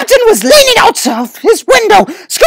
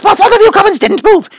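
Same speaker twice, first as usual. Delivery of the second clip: usual speaking voice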